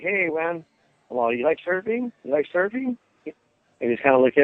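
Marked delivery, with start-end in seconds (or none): 0.0-3.0 put-on gently coaxing a child. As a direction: coax a shy kid with lifted pitch.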